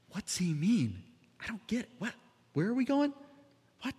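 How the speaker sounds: high bewildered mumbling; confused disciples. Retold or imitated. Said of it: "looking at each other going".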